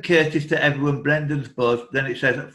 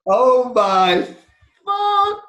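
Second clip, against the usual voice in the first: much higher, louder, drawn-out gasp of disbelief; shocked outburst; 9 semitones higher, 7 dB louder, much slower than usual. Real, not acted. Quiz host reacting as a player buzzes, stunned. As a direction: let it stretch out in real shock.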